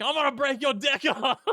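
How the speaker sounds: high, laughing mock threat; Monique. Quoting the sex scene to co-host, laughing high impression. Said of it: "but then it cuts to Monique".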